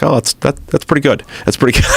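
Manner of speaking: a lightly voiced seven-year-old, unimpressed; impersonating a young son; kid voice. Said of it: "my son Ewan's like".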